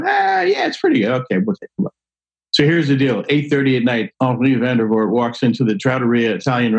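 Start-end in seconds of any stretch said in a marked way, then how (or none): 0.0-1.9 much higher, pleased mock diner voice; delighted diner. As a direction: squeal like a pleased diner tasting the wine. Diner tasting the free wine.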